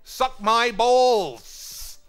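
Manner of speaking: drawn-out high taunting yell; gloating shout. Acted. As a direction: stretch the taunt out slowly and loudly.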